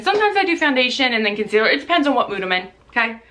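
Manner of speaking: normal talking voice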